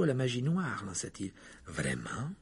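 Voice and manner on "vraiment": low, soft, absent reply; distracted. A narrator's reading. Lupin barely reacts to Harry's warning, distracted. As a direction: answer without really listening. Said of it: "dit Lupin d'un air distrait".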